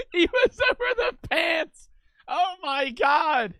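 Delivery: high and loud, laughing through words; laughing in disbelief. Real, not acted. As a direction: squeal it out while laughing. Shocked reaction to clip.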